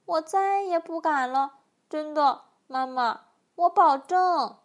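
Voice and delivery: put-on pleading, scolded-child whine; hammed-up tearful apology; whimpering. A narrator's reading. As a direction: beg mother, stretching the last promise. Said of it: "巨人呜咽着说".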